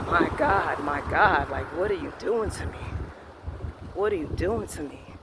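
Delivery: low, hushed, half-whispered plea to God; barely voiced, as if the air has gone out of him; quiet despair. Retold despair as she walks away.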